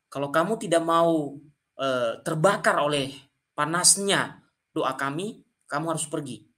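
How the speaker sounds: ordinary delivery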